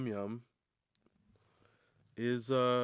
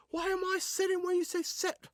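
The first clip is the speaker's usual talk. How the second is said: much higher put-on squeaky voice; indignant dog voice. The dog questioning its owner, high squeaky voice. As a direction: question it like a cheeky pup.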